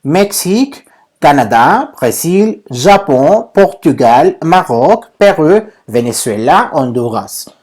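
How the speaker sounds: lista a la francesa, "México" como "mexík"; pronunciación francesa modelo. Shown as a demonstration. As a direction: cada país separado, de "Canadá" a "Honduras", con acento en la sílaba final. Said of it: "Repito nada más la pronunciación". (dicta "Japón" y "Perú" a la francesa, subiendo al final)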